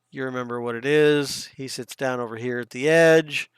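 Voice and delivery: ordinary talk, nothing marked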